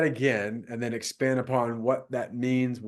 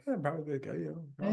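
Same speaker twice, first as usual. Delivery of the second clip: soft, hesitant mumbled agreement; half-hearted agreement; 4 semitones higher, 8 dB quieter than usual. Acted. Agencies answering a show of hands on good results, half-hearted. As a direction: imitate a lukewarm audience admitting it quietly.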